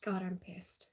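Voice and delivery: hushed, seething under her breath; angry whisper. Real, not acted. To her stream, whispering that she's really pissed. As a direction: whisper the anger so no one hears. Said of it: "I mean, I'm angry".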